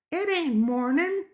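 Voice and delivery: put-on very high, drawn-out little girl gremlin; squeaky gremlin kid; gremlin child. A narrator's reading. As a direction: correct him in a high, slow child voice. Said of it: "the little gremlin said".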